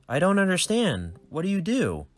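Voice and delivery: put-on high, bright kid voice, eager; a nerdy boy greeting his dad; cheerful young son. Son Wade.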